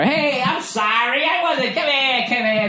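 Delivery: high, soft, slow wheedling; a toxic partner luring you back an hour later; manipulative apology. Retold or imitated.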